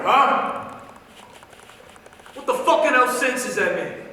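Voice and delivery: loud, worked-up venting; outraged rant. Acted. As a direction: rant in loud disbelief at the senselessness of it. Outraged rant about brother's danger.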